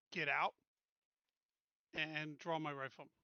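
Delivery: ordinary talk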